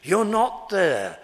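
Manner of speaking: Paul voiced scolding the proud crowd; slow, loud rebuke. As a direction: hammer it home slowly. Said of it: "And his great emphasis is".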